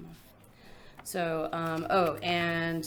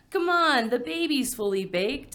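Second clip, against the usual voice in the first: high, louder teasing quip; joking; 8 semitones higher, 6 dB louder than usual. Acted. Poet voicing an imagined joker at the baby shower, high teasing. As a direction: toss it out like a party guest's joke. No one joked.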